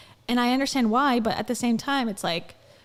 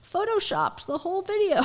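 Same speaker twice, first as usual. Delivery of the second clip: high, sneering skeptic voice; a doubting commenter, pitched up; mock conspiracy commenter; 8 semitones higher than usual. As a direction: scoff like an online doubter.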